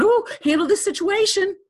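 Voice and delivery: hammed-up high bossy helper; bossy inner voice. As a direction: play the meddling voice that wants to take charge. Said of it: "just like it was out here going".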